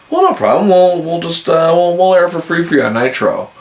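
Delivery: high, breezy mock-helpful company voice; sarcastically playing the company brushing fans off; mock corporate reply. Mocking WCW's offer to fans.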